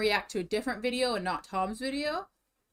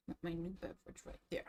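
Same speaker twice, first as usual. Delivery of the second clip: muttered aside; 5 semitones lower, 16 dB quieter, faster than usual. Real, not acted.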